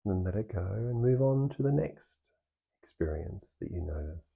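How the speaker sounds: calm meditation guide with long pauses; guided meditation. Real, not acted. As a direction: ease them through it, leaving space between phrases. Leads the meditation practice.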